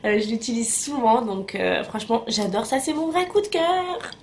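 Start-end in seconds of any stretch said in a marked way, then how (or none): 2.8-4.1 high, rising delight; gushing enthusiasm. Real, not acted. Vlogger revealing a favourite product to viewers, gushing. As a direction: gush about it in a much higher voice.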